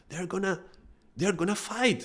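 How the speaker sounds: high-pitched mock alarm; alarmed friend. Scottish friend fears domino players will fight, acted alarm. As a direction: retell a worried warning, voice shooting up.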